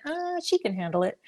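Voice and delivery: high, soft offhand verdict; casual shrug. Retold or imitated. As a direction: toss it off lightly as if deciding on a whim. Imagined God casually picking her.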